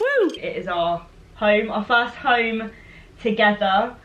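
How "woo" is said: high celebratory whoop; excited whoop. Real, not acted. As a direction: cheer after the toast. Toasting the new home.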